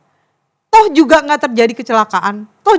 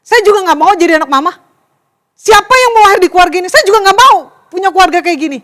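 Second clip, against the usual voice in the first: louder and higher, hurt and defiant; angry child retort; 5 semitones higher, 5 dB louder than usual. Acted. Child yelling back at a scolding mother, angry. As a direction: fling it back at mom. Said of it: "kata-kata kita cepet banget ngomong gini".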